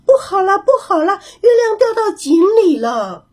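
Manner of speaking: 小猴子惊慌地大叫; frightened cry. A narrator's reading. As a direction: shriek it out as if the moon just fell. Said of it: "小猴子吓得大叫起来".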